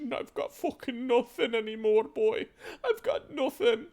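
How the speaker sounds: high, tearful, broken pleading; weeping. Acted. Mother weeping her apology to the son who found her. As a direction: sob out the apology to your son. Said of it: "She begins to just weep uncontrollably".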